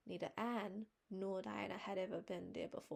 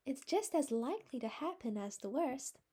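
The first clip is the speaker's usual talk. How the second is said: high, louder earnest plea; pleading; 6 semitones higher, 7 dB louder than usual. A narrator's reading. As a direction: beg her to hope for the best. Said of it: "pleaded Anne".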